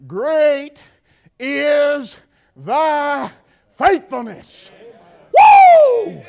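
slow, loud preaching shout; shouted praise. Acted. Preacher crying out God's faithfulness, shouted. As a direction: bellow each word out.

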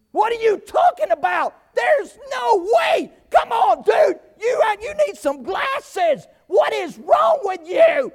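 loud, high shouting at the umpire, drawn out; angry parent yelling. Retold or imitated. Parent in the stands yelling at the umpire, loud and high. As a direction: bellow like an outraged sports parent.

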